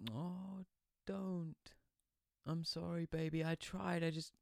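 lightly voiced sorry girlfriend, soft and high. Phoebe apologising to her boyfriend on the phone, softly.

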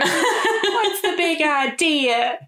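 high, drawn-out starlet impression through laughter; Lena. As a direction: mimic the high-pitched diva, stretching every word. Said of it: "Except she's quite high-pitched".

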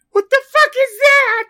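high, loud panicked shriek; terrified scream. Pretend. Host demonstrates the Blair Witch scare, shrieking high and loud. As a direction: scream in horror at the face. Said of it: "I'm going to do the Blair Witch Project".

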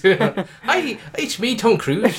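high, slow mock Irish accent; Tom Cruise. Film star playing Irish in a movie, mock Hollywood Irish accent. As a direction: put on a silly film-star Irish lilt. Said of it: "like, Tom Cruise".

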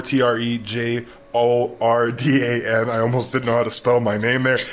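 ordinary talk, nothing marked

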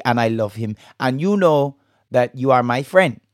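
amateur attempt at a Bajan accent. Shown as a demonstration. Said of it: "do a little Bajan accent".

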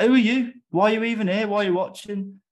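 high, loud imitated rant; mock angry retort. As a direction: fire it back like a riled striker. Dan having a go back.

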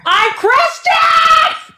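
high, loud indignant cry; mock-offended yell at a friend's jab; outraged shout. Real, not acted. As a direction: shout it like you've been insulted.

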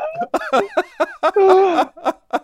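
high burst of real laughter; big laugh. Real, not acted. Player laughing at host's shocked reaction. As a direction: let the laugh break out.

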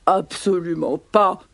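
ponderous elephant denial; protesting elephants. Acted. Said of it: "protestent les éléphants".

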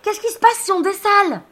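high, loud yell up to a friend; screamed question. Acted. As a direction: scream for answers as the boat tips. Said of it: "Alors je hurle".

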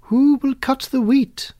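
high, loud brisk hen call; busy hen calling briskly. A narrator's reading. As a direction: rouse the farmyard briskly. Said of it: "calling briskly".

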